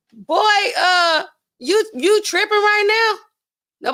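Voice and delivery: high, loud, slowed scolding; scolding auntie. Retold or imitated. Host scolding the rapper, loud and high. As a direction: drag it out like a fed-up aunt. Scolding him in her imagination.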